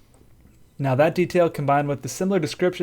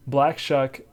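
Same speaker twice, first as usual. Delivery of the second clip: ordinary talk, nothing marked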